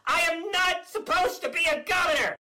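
high, exasperated outburst; frustrated shout. Acted. Governor vents about wanting presidency, shouting. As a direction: blurt it like a tantrum.

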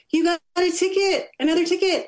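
high-pitched mock scolding; retold anger. Mother scolding her daughter over a parking ticket, mock mad. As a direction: imitate getting mad, pitched well up but not shouted. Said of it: "I would have gotten mad".

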